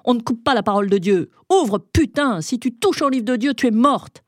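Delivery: enraged men bellowing at a locked door; furious yelling. Acted. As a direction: roar threats through the door. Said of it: "J'ai entendu les hommes hurler".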